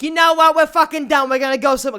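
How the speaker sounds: loud, high imitation of an indignant mom; mock outburst, ready to storm off; fed-up mom. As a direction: impersonate a mother blowing up, loud and high. Mom fast to quit in anger.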